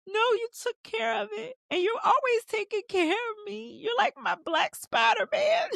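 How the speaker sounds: high, hammed-up blubbering, rising to a wail; mock crying. Issa thanks her man, hammed-up high crying voice. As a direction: play her sobbing thanks, overdone for laughs. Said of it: "she starts crying in this moment".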